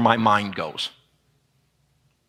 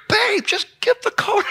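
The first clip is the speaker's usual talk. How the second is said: way higher, squeaky pleading; squeaky high husband. Retold or imitated. Husband begging wife to share the code, squeaky high. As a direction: pipe it up high like a whiny husband. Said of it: "I'm like".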